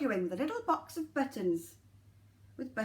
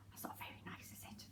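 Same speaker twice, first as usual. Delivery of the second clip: whispered aside; 20 dB quieter, faster than usual. Real, not acted. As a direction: confide in a whisper. Aside to children.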